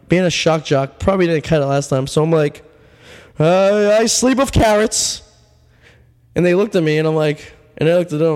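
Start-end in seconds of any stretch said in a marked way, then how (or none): 3.4-5.2 high, slow, hesitant confession; awkward confession. Retold or imitated. Telling the interviewers his odd fact, high and hesitant. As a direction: blurt out the weird fact nervously.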